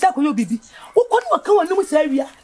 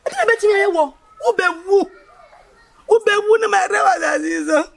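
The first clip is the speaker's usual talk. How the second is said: tearful sobbing plea, breaking into a cry; 2 semitones higher than usual. Acted. Sister pleading with her friend, crying. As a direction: weep through the words, voice cracking. Sister weeps over threatened death.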